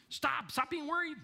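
high-pitched mock exasperated order; exasperated command. Imitating advice to an anxious person, exasperated. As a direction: imitate someone ordering worry away.

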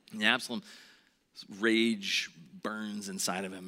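ordinary talk

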